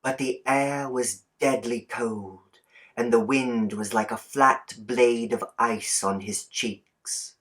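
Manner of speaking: ordinary delivery, nothing marked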